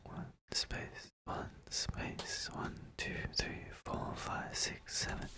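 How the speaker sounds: faint whispered counting under breath; barely audible whisper. Real, not acted. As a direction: count almost silently to yourself.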